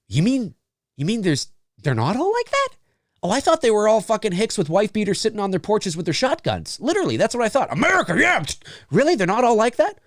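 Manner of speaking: high-pitched, incredulous put-on voice; mock disbelief. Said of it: "a Canadian that would go".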